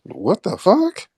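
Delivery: high, drawn-out incredulous what; incredulous reaction. Retold or imitated. Host retelling his side's reaction to online claims, incredulous. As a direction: voice shoots up in stunned disbelief. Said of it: "We're like".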